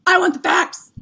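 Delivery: high, loud movie-style demand; mock shout. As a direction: belt it like a courtroom showdown. Demanding facts in the argument.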